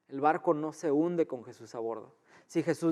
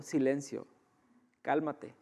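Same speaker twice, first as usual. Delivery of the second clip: orden suave y serena; tranquilo; 7 dB quieter than usual. Acted. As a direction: mandar callar sin necesidad de gritar, en voz baja. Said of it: "tranquilamente dijo". (dicho tranquilamente, con calma)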